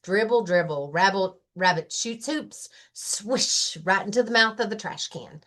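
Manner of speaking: usual speaking voice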